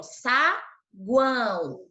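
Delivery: Saguão drawn out slowly, each vowel held clear; slow teaching demo. Shown as a demonstration. Teacher to pupils showing a tritongo, slow drawn-out demo. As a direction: stretch the word so pupils hear the three vowels. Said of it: "Ficou três vogais juntinhas aqui".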